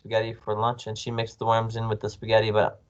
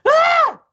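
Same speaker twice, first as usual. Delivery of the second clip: loud, very high shriek; scream; 11 dB louder than usual. A narrator's reading. Wife feeling something slimy in bed, screaming. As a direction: shriek in sudden horror. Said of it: "She screamed".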